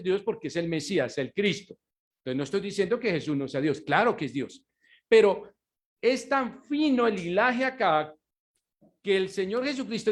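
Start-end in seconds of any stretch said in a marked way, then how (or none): none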